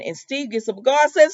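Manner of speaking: ordinary delivery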